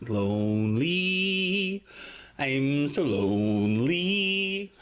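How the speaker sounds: slow, drawn-out fake lament; mock lonely wail. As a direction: moan it like a sad ghost.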